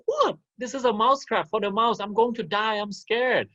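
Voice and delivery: high, frightened squeaky mouse voice; scared mouse. Acted. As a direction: squeal it out like a terrified little mouse. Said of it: "the mouse is very scared".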